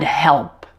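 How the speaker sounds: HELP with a dark L before the P. Shown as a demonstration. Teacher giving example words, dark L in HELP. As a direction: raise the tongue back on the L in HELP. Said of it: "dark L at the end of words".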